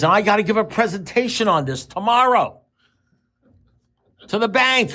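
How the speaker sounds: high-pitched, worked-up imitation of a caller; retold indignation, climbing high on key words; indignant outcry. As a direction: pitch it way up in mock outrage. Same worked-up caller, acted out.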